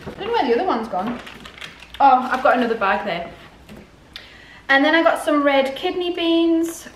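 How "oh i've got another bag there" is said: ordinary delivery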